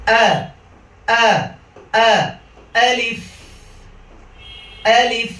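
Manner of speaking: high, firm "আ" thrown from the throat, then "আলিফ"; alif recitation drill. Shown as a demonstration. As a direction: recite "আ" short and hard, then "আলিফ" ending in a held f. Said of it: "প্রথমে আলিফ দিয়ে শুরু করছি".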